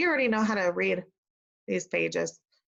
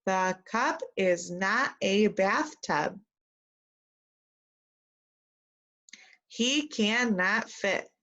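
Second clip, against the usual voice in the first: put-on slow, careful sentence for kids to echo; slow model reading; much slower than usual. Shown as a demonstration. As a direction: read it slowly, one word at a time. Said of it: "Repeat after me".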